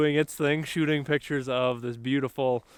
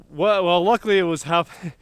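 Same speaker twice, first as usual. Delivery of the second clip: too much caffeine; 4 semitones higher, 6 dB louder than usual. Real, not acted. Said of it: "I'm a little bit of a caffeine high right now".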